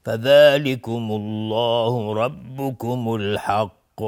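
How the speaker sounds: slow, drawn-out melodic Quranic chanting; Quran recitation. Shown as a demonstration. Tajwid teacher reciting a verse for students, slow chant. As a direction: chant the verse with long held vowels. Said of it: "حکمش استعلا است".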